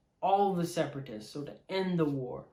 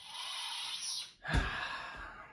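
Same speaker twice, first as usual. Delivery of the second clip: heavy sigh; 6 dB quieter than usual. Real, not acted. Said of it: "makes me really sad and really emotional".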